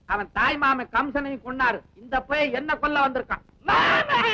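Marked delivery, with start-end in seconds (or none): 3.6-4.3 high shrieking shout of the word; mock child's yell. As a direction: scream it like a frightening child. Husband mimics child's scary cry. (மாமன் என்று பயமுறுத்தும் கத்தல்)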